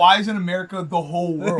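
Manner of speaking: loud mock outrage; over-the-top patriotic joke; joking exaggeration. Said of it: "I'm kidding".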